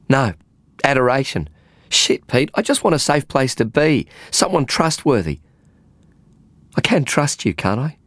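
begging for somewhere safe to be; a frazzled woman pleading with rising pitch. Acted. Faye voiced by narrator.

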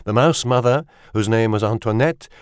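ordinary delivery

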